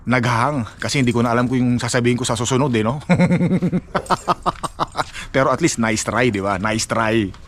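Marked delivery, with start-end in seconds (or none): 3.1-5.3 high rippling giggle; chuckling. Real, not acted. Vlogger admitting his English hangs midway, giggling. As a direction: giggle helplessly at yourself.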